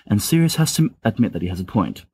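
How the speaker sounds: ordinary delivery, nothing marked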